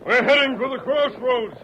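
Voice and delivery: high, loud call over the horses; calling out while riding. Acted. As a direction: raise your voice above the gallop. Riding at night, calling over hooves.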